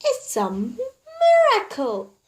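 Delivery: high, breathless with wonder; thrilled lady. A narrator's reading. Mrs Silver praises Mr Hoppy's magic, high thrilled voice. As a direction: gush with amazed delight.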